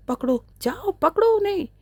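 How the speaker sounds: high, loud yell at his men; shouted order. A narrator's reading. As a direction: bark it out to the thugs to chase them. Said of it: "चीख कर बोला".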